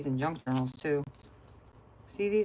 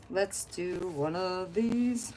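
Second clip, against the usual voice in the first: slow sing-song drawl; 3 dB quieter, much slower than usual. Pretend. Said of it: "repeated line, said differently".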